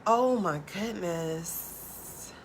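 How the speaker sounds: ordinary delivery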